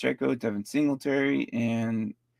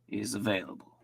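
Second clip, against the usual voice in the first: ordinary talk, nothing marked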